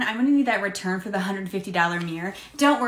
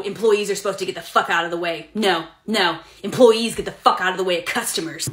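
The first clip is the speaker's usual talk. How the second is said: normal talking voice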